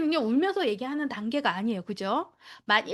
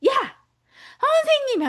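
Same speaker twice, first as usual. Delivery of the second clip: put-on much higher, louder yell of a child; child calling out; 13 semitones higher, 7 dB louder, slower than usual. Child yelling for the teacher, high and loud. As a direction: shout across the room for the teacher.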